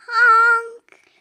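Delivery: high, loud frightened honk; scared honk. A narrator's reading. Stuck dump truck calls for help, scared high honk. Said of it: "and he sounded scared".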